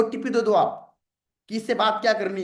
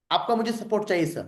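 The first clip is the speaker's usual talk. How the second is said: normal talking voice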